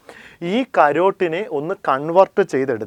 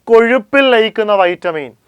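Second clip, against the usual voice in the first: emphatic teaching; 6 semitones higher, 7 dB louder than usual. Shown as a demonstration. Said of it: "രണ്ടായി തിരിക്കാം".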